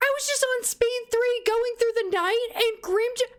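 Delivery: high, near-tearful panic; distressed disbelief. Real, not acted. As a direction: pour it out in shocked distress. Explains the death in distress.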